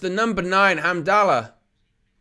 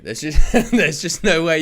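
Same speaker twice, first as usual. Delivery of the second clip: laughing disbelief, slightly louder; real laugh mid-sentence; 4 dB louder than usual. Real, not acted. Commentator reacting to Morocco's failure to score, laughing in disbelief. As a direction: break into a laugh while talking.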